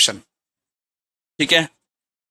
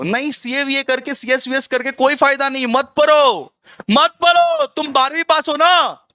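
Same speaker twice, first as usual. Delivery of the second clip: imitated phone salesman, high-pitched and insistent; mock sales pitch, urgently talking someone out of it; persuasive telemarketer; 5 semitones higher than usual. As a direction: play a pushy caller in a raised pitch. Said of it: "वो लोग phone करके इतना अच्छा communicate कर लेगा".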